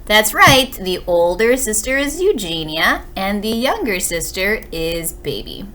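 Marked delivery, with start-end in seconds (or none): none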